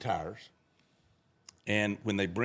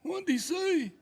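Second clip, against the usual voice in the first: high mock boss question; hammed-up boss demand; mob boss; slower than usual. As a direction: bark it up high. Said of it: "The mob boss goes".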